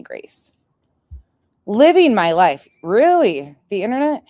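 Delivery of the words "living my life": loud sneering quote; mocking quote. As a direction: throw her words back loudly. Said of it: "mocking her post".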